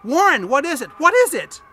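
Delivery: terrified. A narrator's reading. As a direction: yell it down in terror. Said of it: "Terrified, I continued to repeat".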